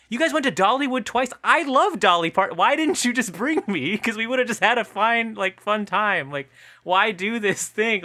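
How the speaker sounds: pleading like a let-down partner; mock complaining partner. Imitating the partner complaining to Zac Efron, whiny. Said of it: "she was like".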